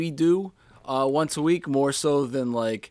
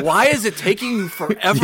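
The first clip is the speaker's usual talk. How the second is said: fast, raised mock complaint; higher, hurried exasperation; imitated impatience; 5 semitones higher, 4 dB louder, faster than usual. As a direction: fret like someone stuck waiting. Imitates Brian waiting in car.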